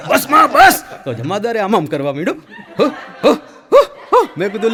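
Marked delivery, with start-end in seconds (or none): 0.1-0.8 high loud trance cry; hammed-up possessed cry; mock shout. 2.8-4.3 high loud rhythmic grunts; hammed-up possessed huffing; trance panting. Policeman starts trance panting.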